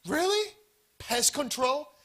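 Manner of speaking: sarcastic shocked question; mock disbelief. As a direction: scoff in disbelief. Mocking the family's choice.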